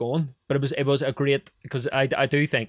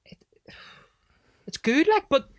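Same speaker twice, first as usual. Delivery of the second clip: imitating the teacher; 10 semitones higher than usual. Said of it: "and he was going".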